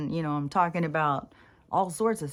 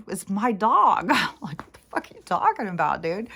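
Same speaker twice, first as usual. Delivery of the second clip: louder, disbelieving comeback to a stranger; incredulous retort; 3 semitones higher, 4 dB louder than usual. Retold or imitated. Answering the stranger who says she's making the dog mad, incredulous. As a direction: fire back at him, baffled and annoyed.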